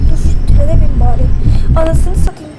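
loud angry shouts at the game; furious yelling. Real, not acted. Gamer losing it at the game, loud furious yelling. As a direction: rage at the screen, full volume.

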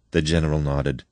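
ordinary delivery, nothing marked

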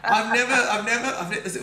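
high, loud breathless stammer; awestruck stammer. Retold or imitated. Thanking bartender in disbelief, high loud stammer. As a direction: trip over the words, overwhelmed.

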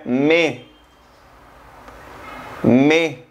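me said like French mai; me, short and clear; French pronunciation. Shown as a demonstration. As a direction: mai à la française. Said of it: "mayo se pronuncia".

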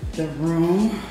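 ordinary delivery

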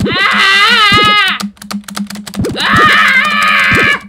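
long, loud held "aah"; frustrated scream. Pretend. Gamer trapped in the game lets out a frustrated scream. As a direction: scream high and drawn out.

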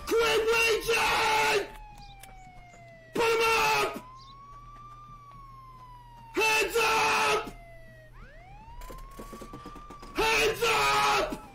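barked police commands at a driver; mock cop shouting. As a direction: yell it like a cop making an arrest.

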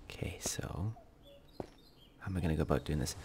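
hushed whisper. Real, not acted. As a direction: keep it quiet while sneaking. Sneaking near enemies.

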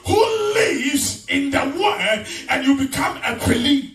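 forceful sermon with stretched key words; full-force preaching. Real, not acted. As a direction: drive each phrase into the congregation.

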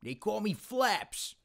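high, slow silly voice; talking butt voice. Shown as a demonstration. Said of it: "Impression of a butt".

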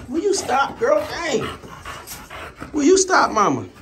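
high, fed-up scolding voice at the dog; high-pitched scolding. Real, not acted. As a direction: squeak it out in exasperation. Dog pestering during the video.

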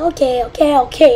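ordinary talk

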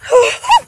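sharp, high, loud gasp of fright; startled gasp. Real, not acted. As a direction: gasp as if something touched you. Scared alone in dark woods.

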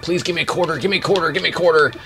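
high, insistent plea repeated in a rush; begging kid. Retold or imitated. Kid begging parents for arcade money, pleading. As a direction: wheedle like a child pestering parents. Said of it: "We would beg, beg our parents".

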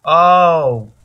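sharp loud oh of shock; loud startled cry. Real, not acted. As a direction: blurt it out when hit. Sudden hit in game.

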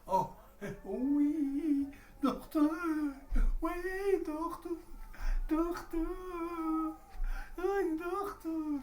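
high, groaning patient calling the doctor; sick patient. Shown as a demonstration. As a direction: moan to the doctor in pain. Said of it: "birinci hasta taklitini yapıyorum".

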